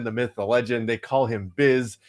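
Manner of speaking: ordinary talk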